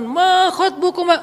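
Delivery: high, drawn-out sermon delivery; preacher's tone. Shown as a demonstration. Khatib who misunderstands the story, slow high proclamation. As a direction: stretch it out like a khatib. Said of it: "Kan kalau khatib berubah".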